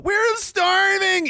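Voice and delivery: high, drawn-out whine; hammed-up cry of hardship; mock whining. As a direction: wail it slowly like a pampered complainer. Mocks people complaining of hardship.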